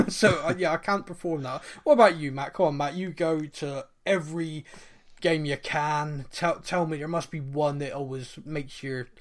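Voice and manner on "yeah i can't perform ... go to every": normal talking voice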